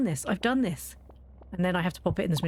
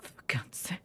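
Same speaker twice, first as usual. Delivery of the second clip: low breathy muttered curse under the breath; exasperated whisper; 7 semitones lower, 9 dB quieter than usual. Retold or imitated. Fed-up mum on the phone with school, whispered.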